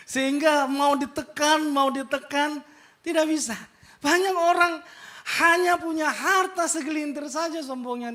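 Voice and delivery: loud, high sermon delivery; preacher thundering at the congregation; fiery preaching. Acted. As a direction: drive it home to the congregation. Preaching at full force.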